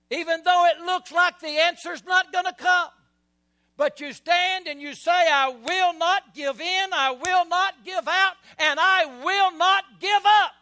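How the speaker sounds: loud, high preaching build; rising fervour, hammering each I will not; climbing, roaring pulpit declaration; preaching at full force. Real, not acted.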